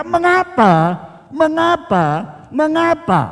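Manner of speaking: imitating a husband pestering his wife; nagging. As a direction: hammer her with the same question. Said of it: "terus menerus dijejak dengan kata-kata".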